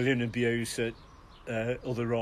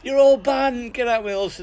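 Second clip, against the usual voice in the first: much higher and louder angry bark; furious barman; 10 semitones higher, 7 dB louder than usual. Retold or imitated. Barman banning the rowdy racers, furious shout. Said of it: "the gaffer's gone mad".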